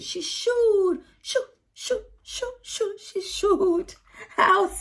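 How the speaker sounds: high crying shoo shoo; shooing demo. Shown as a demonstration. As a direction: chase the hens off with high shoos. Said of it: "So you have to demonstrate".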